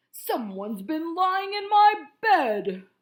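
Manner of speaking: slow, loud bear grumble; sputtering Papa Bear. A narrator's reading. Papa Bear finding his bed slept in, slow and loud. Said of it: "Papa Bear sputtered".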